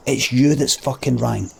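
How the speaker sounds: normal talking voice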